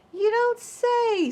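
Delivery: high, slow, mock-surprised wolf; sly wolf. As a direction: drawl it out, pretending interest. Wolf feigns interest.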